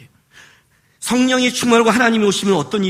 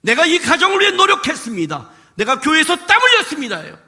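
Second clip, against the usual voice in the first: proud believer boasting; 8 semitones higher, 3 dB louder than usual. Retold or imitated. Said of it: "거기에 뭐가 들어가냐면".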